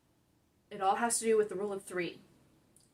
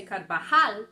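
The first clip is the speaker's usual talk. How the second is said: Carvajal said car-va-HAL, end syllable louder; stress on last syllable; 9 dB louder, slower than usual. Shown as a demonstration. Teacher asking how she knew to say it, final-syllable stress on Carvajal. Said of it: "always stress the last syllable".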